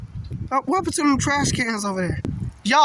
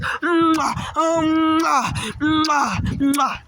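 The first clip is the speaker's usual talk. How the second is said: air kisses; 2 semitones higher, much slower than usual. Real, not acted. Kissing at the storm.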